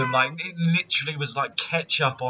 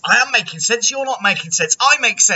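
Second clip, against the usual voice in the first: mock bickering; 9 semitones higher than usual. Acted quarrel from the film.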